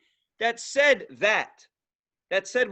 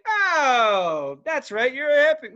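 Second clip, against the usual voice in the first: louder, higher sarcastic dawning; mock realization; 5 semitones higher, 5 dB louder, slower than usual. Mocking a broke hippie, louder sarcastic dawning. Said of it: "I'm like".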